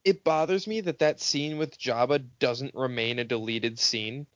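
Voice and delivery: ordinary talk, nothing marked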